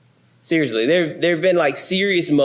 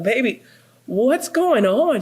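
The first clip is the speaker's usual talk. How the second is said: concerned husband; 7 semitones higher than usual. Retold or imitated. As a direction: coax her gently like a worried husband. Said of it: "And I'm like".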